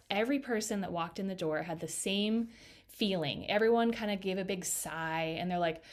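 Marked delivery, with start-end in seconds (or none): none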